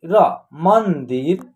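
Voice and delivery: मंदिर said very slowly, man-dir; slow blended word. Shown as a demonstration. Teacher slowly blends मंदिर for the children. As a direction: stretch मंदिर out syllable by syllable. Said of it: "द में छोटी ई की मात्रा दी".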